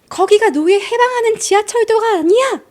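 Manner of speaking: put-on woman shouting at children to get off her lawn; angry neighbor yelling. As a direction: yell it like a furious neighbor chasing kids off. Acted neighbor screaming at kids.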